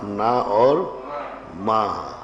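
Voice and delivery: usual speaking voice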